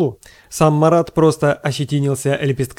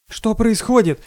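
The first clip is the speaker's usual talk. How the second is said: higher-pitched, lightly acted fright; a mild squeal of alarm; frightened squeal; 7 semitones higher than usual. A narrator's reading. Frightened girl demanding to know what is happening, squealing lightly. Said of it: "испуганно завизжала Дарья".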